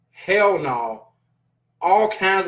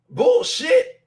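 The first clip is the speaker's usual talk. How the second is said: much higher, rising shriek of "Bullshit"; shrieking, incredulous "Bullshit"; squealed disbelief. Real, not acted. Rejecting the money excuse.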